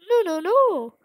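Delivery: lightly voiced gentle goat mother; a hint of a caring motherly voice; Toriel voice. Goat mother greeting a fallen child, a touch motherly.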